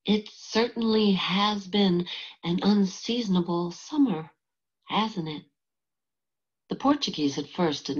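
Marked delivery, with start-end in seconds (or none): 0.0-5.4 higher, lightly tremulous character line; slightly trembling voice. A narrator's reading. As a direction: read it with a touch of a tremble. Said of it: "in a voice that trembled a little".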